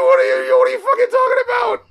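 very high, loud, fast outraged yell; shrieking outrage. Real, not acted. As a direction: scream your disbelief. Outraged at the outro.

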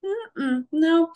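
standing up for herself, rising to no; firm refusal. Retold or imitated. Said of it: "I was like".

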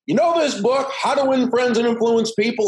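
playful imitation of an old sales speaker; Charlie Jones. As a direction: hold the book up and tease the crowd. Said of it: "a Charlie Jones imitation".

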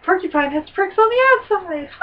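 high, emphatic punchline; punchline delivery. Pretend. Co-host springs a corny joke on Steve, high punchline delivery. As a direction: land the joke high and proud.

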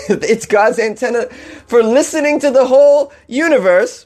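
giggling while repeating the reverend; laughing mock quote. Mocking the reverend's line, laughing.

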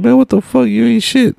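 hammed-up mocking intern voice; mock intern insult. Imagined intern mocking him.

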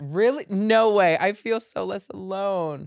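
high, loud, delighted disbelief; excited surprise. Real, not acted. Host hearing guest's cousin does accents too, excited surprise. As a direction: burst out, relieved to have company.

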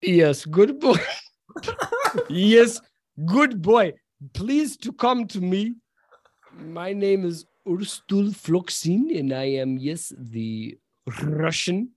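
high, slow Russian mercenary voice; thick Russian accent. Acted. Mercenary introducing himself to Gareth, thick Russian accent. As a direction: lay on a hammy, halting Russian accent. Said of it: "with a very thick Russian accent, he says".

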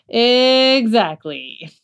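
slow drawn-out "Exactly" rising; emphatic. Real, not acted. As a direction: stretch "Exactly" with a rise. Emphatic agreement.

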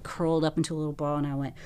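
usual speaking voice

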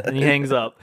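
ordinary talk, nothing marked

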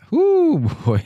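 laughing whoo. Real, not acted. Caller shaking his head at life's troubles, laughing whoop. Venting about life's hard knocks.

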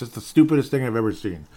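normal talking voice

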